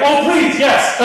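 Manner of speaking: high, loud Irish relief; mock Irish plea, loud and high; Irish waitresses. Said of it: "they're like".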